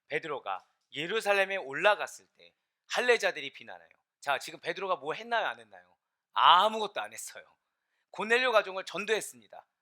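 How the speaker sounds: loud, punchy sermon retelling; emphatic preacher questioning and answering; forceful preaching. Real, not acted. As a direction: hammer the key words for the congregation.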